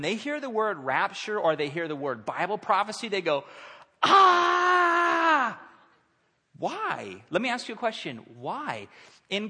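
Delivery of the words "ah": high, loud comic shriek; long hammed-up scream of panic; mock terrified scream. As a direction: scream as if horrified, for laughs. Imitating people panicking at rapture talk.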